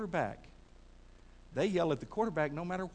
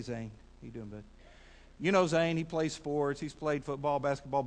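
ordinary talk

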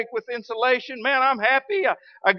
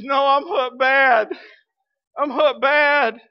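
slow, higher, louder pained groan; pained reply; 5 semitones higher, 4 dB louder, slower than usual. Retold or imitated. Willie answering the pastor from the hole, slow pained voice. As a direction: sound hurt and miserable.

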